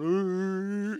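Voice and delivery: teasing, held vocal whirr; mocking machine noise. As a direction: playfully mimic a whirring noise in one long note. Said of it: "making fun of me".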